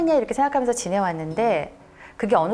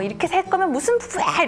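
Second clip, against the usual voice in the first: retold angry shout with raised pitch; imitated outcry; 9 semitones higher than usual. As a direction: act out a wife's indignant cry. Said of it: "소리를 외치셨던 겁니다".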